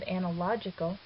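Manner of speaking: analogical stressed on LOG, ana-LOG-ical; stress on third syllable. Shown as a demonstration. Teacher showing the accent moved again, stress on third syllable. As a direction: put the weight on LOG in analogical. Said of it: "the accent's moved again".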